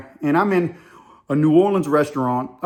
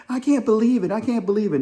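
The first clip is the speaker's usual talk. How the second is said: higher, mock-exasperated woman; imitated disbelief; 6 semitones higher than usual. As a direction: lightly mimic her worked-up disbelief. Acts woman who won't calm down.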